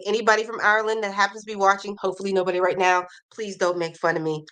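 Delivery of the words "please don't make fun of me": usual speaking voice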